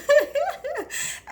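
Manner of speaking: sudden high squealing laugh; high burst of laughter. Real, not acted. Laughing after crying memory.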